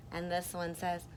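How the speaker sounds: usual speaking voice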